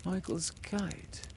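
slow, soft, indifferent; without interest. Acted. Exhausted Peter noticing the kite without interest. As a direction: murmur it dully. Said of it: "Peter said without interest".

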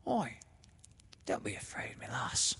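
whispered, sly, fond coaxing. A narrator's reading. The sister coaxing Laura toward the bed, fond and sly whisper. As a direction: coax her closer in a whisper. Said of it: "her voice sounded fond and sly".

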